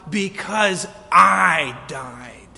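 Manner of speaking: slow, loud, drawn out; slow and shouted, every word weighted; shouted proclamation. Acted. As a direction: land the climax with a shout. Climax of preached quote.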